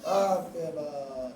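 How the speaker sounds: grieving lament. Acted. Sakina's lament, voice breaking.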